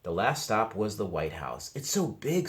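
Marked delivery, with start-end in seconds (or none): 1.7-2.5 high wondering voice; awed boy. A narrator's reading. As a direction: gasp it out in awe. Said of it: "said Arthur".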